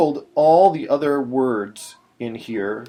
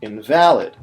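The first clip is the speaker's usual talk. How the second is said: invalid as in-VAL-id, louder; "invalid" meaning no longer valid; stress on second syllable; 2 semitones lower, 6 dB louder than usual. Shown as a demonstration. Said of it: "something that's no longer valid".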